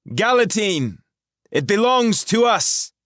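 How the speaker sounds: high, loud commanding woman's voice; a sorceress demanding answers, loud and clear. A narrator's reading. Rahal challenges the intruders, high and commanding.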